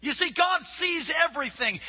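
usual speaking voice

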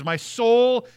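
a slow, swelling cry of devotion; fervent devotion. Acted. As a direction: pour longing into my soul clings. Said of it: "What it really means is".

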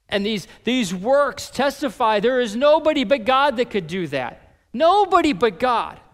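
loud, high climax of the sermon; preaching at full force. Acted. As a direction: drive the point home to the congregation.

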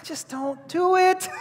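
slow, high-pitched pained plea; exasperated plea. Acted. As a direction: beg them to stop, drawn out and high. Mock pleading to a gross kid.

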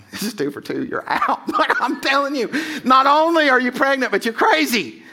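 high, loud mock disbelief with a laugh; incredulous Joseph. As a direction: play Joseph reacting to Mary's text in disbelief, high. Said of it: "and Joe's like".

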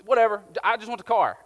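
acted "don't care, just sell it"; impatient brush-off. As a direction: cut off the warnings, eager for the car. Impatient buyer brushes off warnings.

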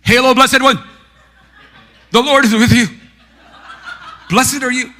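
frantic high shout in short bursts; hammed-up angel bellowing lines while spinning; Gabriel. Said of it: "delivered his lines whenever he saw audience".

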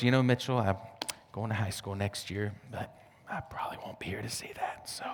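put-on soft, half-whispered old lady's gloom; whispered grandma lament. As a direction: breathe it like a fading grandmother. Grandmother voiced again, whispered.

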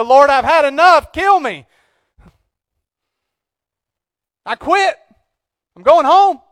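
high, loud and worn out with despair; despairing Elijah. Retold or imitated. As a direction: cry out to the Lord that you quit. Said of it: "I'm like Elijah".

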